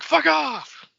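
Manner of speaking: high, shrill parting yell; mock yell. Friend voicing the prisoner's parting shot, mock yell. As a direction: fling it like a petty insult.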